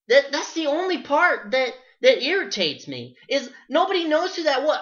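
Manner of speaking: high, loud, fed-up rant; exasperated rant. Real, not acted. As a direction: vent at the bandwagon fans. Ranting about bandwagon fans.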